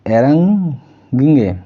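এরাঙ্গিঙ্গে stretched out slowly; slow pronunciation demo. Shown as a demonstration. Teacher spelling out a Tamil word for students, slow. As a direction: draw out এরাঙ্গিঙ্গে so learners catch each sound. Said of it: "Tamil ভাষায় সেই যে".

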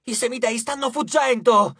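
high alarmed warning; urgent goddess shouting; yell. A narrator's reading. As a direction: cry out that they are escaping. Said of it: "urlò Eris".

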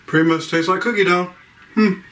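speaking between chews; talking while chewing. Real, not acted. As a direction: mouth partly full. Seen 0: Bite taken at -4; mouth still working food while speaking.

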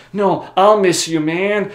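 high, loud, drawn-out reassurance; earnest protest. Acted. Friend insisting he will miss him, mock earnest plea. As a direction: protest with hammed-up feeling. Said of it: "Axel's like".

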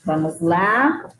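ordinary talk

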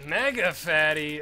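slow, loud, high cartoon boom; mock monster voice. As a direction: announce it like a goofy boss. Reacting to big enemy.

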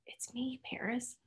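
breathy secret whisper. Acted. Tally hiding from pursuers, whispering her name to Paris. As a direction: whisper so pursuers won't hear.

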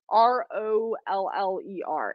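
R O L L E R spelled letter by letter, slowly. Shown as a demonstration. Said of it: "Violet Craft Roller".

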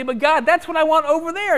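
loud, high pleading whine; whiny plea. Retold or imitated. Believer pleading with God, loud and whiny. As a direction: beg like a child wanting the forbidden thing. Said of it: "We might say".

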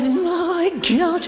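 a quick hammed-up sitcom exclamation; Leonard Rossiter. Mum in a sitcom spoof, mock Leonard Rossiter exclamation. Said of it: "because she's Leonard Rossiter".

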